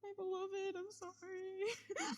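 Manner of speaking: high, slow, hammed-up sob; high drawn-out fake sob; mock crying. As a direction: overdo the weepy apology. Player hams tearful apology.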